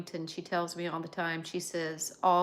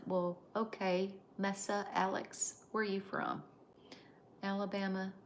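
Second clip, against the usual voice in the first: usual speaking voice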